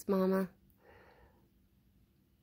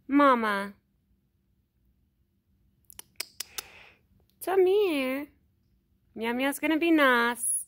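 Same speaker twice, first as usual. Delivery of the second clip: higher, louder sing-song call to an animal; coaxing pet call; 4 semitones higher, 7 dB louder than usual. Real, not acted. Vlogger calling Mama and hushing the cats, coaxing loud call. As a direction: coax the shy animal closer, calling out.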